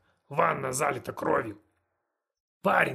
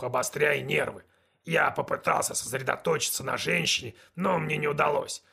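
ordinary delivery

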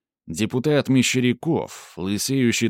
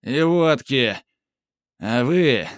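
put-on drunk voice; 4 semitones higher, 4 dB louder, slower than usual. A narrator's reading. Drunk deputy keeps shouting.

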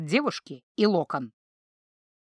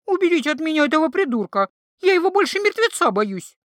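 much higher, louder panicky protest; frightened shriek; 7 semitones higher, 5 dB louder than usual. A narrator's reading. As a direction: squeal from behind his back. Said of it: "взвизгнула и... возмутилась". (испуганно и возмущённо, визгливо)

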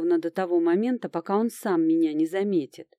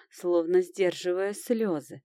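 usual speaking voice

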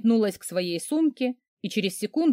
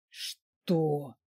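wheezing; 5 semitones lower, 6 dB quieter than usual. A narrator's reading. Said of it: "просипел этот мерзавец".

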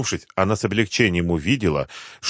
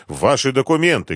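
raised, higher-pitched official demand; крик на бегу; 6 semitones higher, 3 dB louder than usual. A narrator's reading. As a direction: call out the order with authority. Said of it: "кричал на бегу капитан". (требовательный окрик)

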